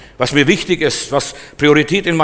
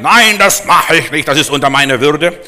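stubborn refusal; 6 dB louder than usual. Retold or imitated.

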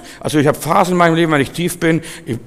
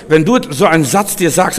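ordinary talk

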